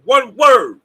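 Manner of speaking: loud, punching out "one word"; shouted emphasis. Real, not acted. Preaching to his viewers with a loud shout. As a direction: drive the point home with a shout.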